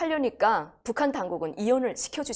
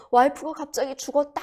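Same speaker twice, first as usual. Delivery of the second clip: raised, louder voice reporting a sudden death; mock-shocked outcry; acted shock; 7 semitones higher, 5 dB louder than usual. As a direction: imitate a husband's startled announcement. Husband feigns shock at death.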